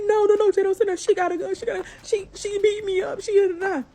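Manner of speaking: hammed-up pleading, half crying; imitating crying neighbor. The beaten neighbor begging Jay at the door, mock crying.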